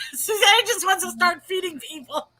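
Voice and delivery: real laughter carrying the whole line; laughing through words. Real, not acted. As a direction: crack up while teasing a friend. Cracking up at friend's sandwich joke.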